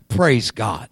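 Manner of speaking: ordinary talk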